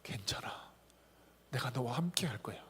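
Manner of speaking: tender whispered comfort; 다정한 속삭임. Acted. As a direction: whisper it close to a hurting friend. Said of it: "그 예수님이 오늘 우리에게 말씀하세요".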